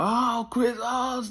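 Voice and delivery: high panicky worried coach; paranoid coach. Retold or imitated. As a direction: whine like a terrified coach. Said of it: "My coach is paranoid".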